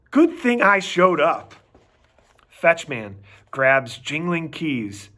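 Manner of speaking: usual speaking voice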